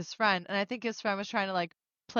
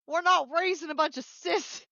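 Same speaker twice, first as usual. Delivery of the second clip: drawled Southern line, rising higher to the end; Southern accent quote; 8 semitones higher than usual. Retold or imitated. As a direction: ham up a Southern mom at dinner. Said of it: "that accent's so fun".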